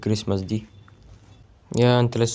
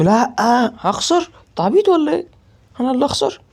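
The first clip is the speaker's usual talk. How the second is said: mock disbelief; 13 semitones higher, 4 dB louder than usual. Mocking the idea of losing.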